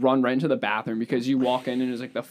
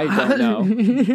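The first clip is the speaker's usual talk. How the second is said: laughing; 8 semitones higher, 5 dB louder, much slower than usual. Real, not acted. Laughing at own story.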